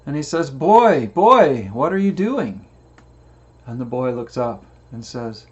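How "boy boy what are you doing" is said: put-on louder, higher old man calling. Old man on shore calls out to the boy, loud higher call. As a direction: call across the rocks to the boy.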